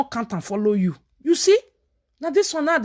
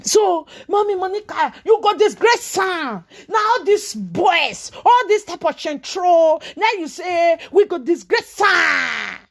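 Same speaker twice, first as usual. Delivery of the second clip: raised scolding; 6 semitones higher, slower than usual. Real, not acted. Scolding Mommy Monica, rising into a shout. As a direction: call her out, voice climbing.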